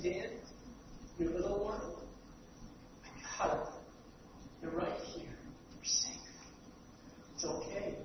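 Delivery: a hushed, barely voiced reassurance; tender comfort. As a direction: comfort a grieving father softly. Jesus voiced comforting grieving father.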